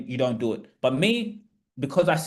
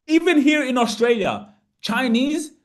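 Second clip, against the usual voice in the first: higher and louder, driving the point home; passionate emphasis; 8 semitones higher, 4 dB louder than usual. Real, not acted. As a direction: press the example on the audience. Passionate point to webinar audience.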